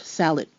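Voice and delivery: salad with the final d dropped; English salad, dropped d. Shown as a demonstration. Teacher showing how English drops the d. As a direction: say salad lazily, no d at the end. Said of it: "We basically kinda sorta drop the D".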